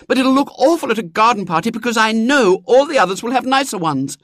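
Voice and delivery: put-on high, loud petulant girl; a girl insisting after stamping; stamping little girl. A narrator's reading.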